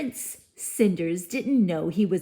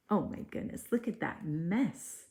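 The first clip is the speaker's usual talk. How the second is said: hushed; 9 dB quieter than usual. Real, not acted. Reader reacts to picture.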